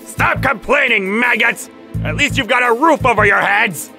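high, forceful scolding shout; angry overseer berating. Acted. Overseer to new workers grumbling about their room, angry shout. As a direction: bawl at them like an overseer.

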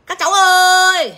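high, slow, drawn-out "Các cháu ơi"; mock auntie call. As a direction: call the kids like a fussy auntie, dragging it out. Mocking call to the kids.

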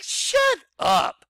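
high, loud dismissive snap; brushing off letter writers with a high snap; exasperated retort. Retold or imitated.

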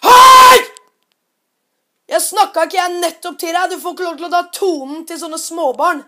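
the father's furious rebuke, slowed down; angry shout. Retold or imitated. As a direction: scold a grown man for bullying kids. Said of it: "sier faren til Philip".

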